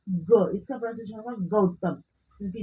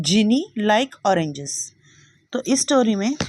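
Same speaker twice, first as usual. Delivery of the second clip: loud classroom voice; 12 dB louder than usual. Real, not acted.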